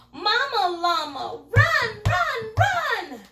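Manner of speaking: high, loud frantic cry for mom; scared child calling mom. Acted. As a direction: scream for mama to come running. Baby llama shouts for mama.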